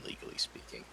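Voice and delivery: whispered aside; breathy hushed afterthought; whispering. Acted. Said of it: "aside secret".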